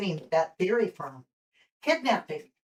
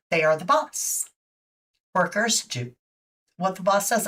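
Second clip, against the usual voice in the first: talking through hiccups; words broken by hiccups; hiccuping. Real, not acted. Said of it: "I don't know why I'm hiccuping today".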